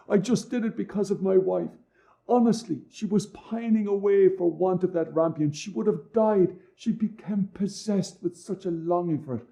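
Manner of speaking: high, anxious begging; frightened pleading. Acted. Man begs the witch for mercy.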